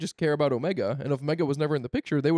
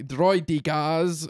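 very slow, mock Japanese-accented "Droidicas"; Nute Gunray; much slower than usual. Imitating a character for co-hosts, drawn-out Japanese-style accent. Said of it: "he just says it like".

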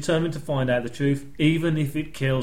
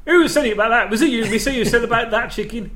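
higher, louder imitation of a cross cook; mock angry chef; 7 semitones higher, 4 dB louder than usual. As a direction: bark the question at the customers. Podcast host acts out an angry chef.

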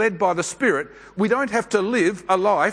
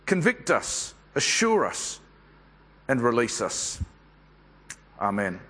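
low, soft prayer to the Holy Spirit; hushed congregational prayer, settling lower to the Amen; 4 semitones lower, 3 dB quieter than usual. Real, not acted. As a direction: pray gently in short, spaced petitions. Leads an opening prayer.

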